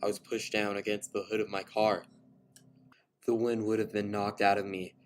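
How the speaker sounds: ordinary delivery, nothing marked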